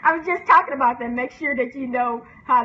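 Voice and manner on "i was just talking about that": loud burst of laughter; loud laugh then laughing words. Real, not acted. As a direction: laugh through the line, louder. Laughing at irony.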